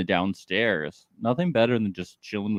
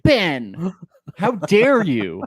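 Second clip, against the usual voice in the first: mock outrage; 7 semitones higher, 6 dB louder, much slower than usual.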